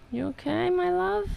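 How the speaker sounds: high, sing-song cooing to the cat; high cooing pet voice, soft and concerned. Real, not acted.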